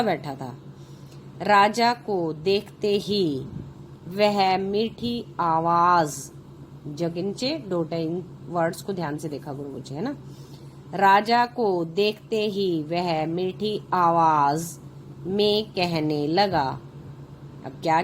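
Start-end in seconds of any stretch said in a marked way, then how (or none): 1.4-6.3 put-on slow, clear reading for children; story narration. A narrator's reading. Reads the story aloud. 10.9-16.8 put-on slow, clear reading for children; story narration. A narrator's reading. Reads the story aloud.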